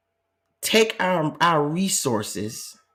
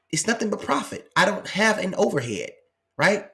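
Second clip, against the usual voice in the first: ordinary delivery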